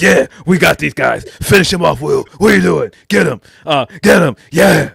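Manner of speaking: loud, high hype-man yell; Moxley urging. Retold or imitated. Moxley yells at Will to finish off Nick, loud and high. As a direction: egg on a partner to finish the job. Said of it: "He's like".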